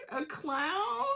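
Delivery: high, drawn-out rising question; mock-offended disbelief. Acting out people offended at being called a clown, mock disbelief. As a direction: squeal it as an appalled question.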